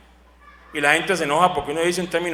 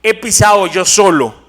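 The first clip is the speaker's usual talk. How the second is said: louder, slow proclamation of Isaiah; proclaiming scripture; 2 semitones higher, 5 dB louder than usual. Acted. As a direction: proclaim the prophet's words.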